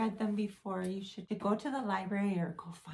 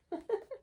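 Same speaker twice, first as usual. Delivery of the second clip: a quick high giggle; short high laugh; sudden high giggle; 14 semitones higher than usual. Real, not acted. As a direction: giggle at the page. Giggle at the uh-oh page.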